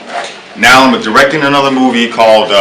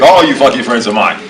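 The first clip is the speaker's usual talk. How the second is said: ordinary delivery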